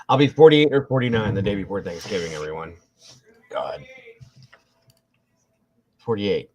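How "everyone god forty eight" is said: ordinary talk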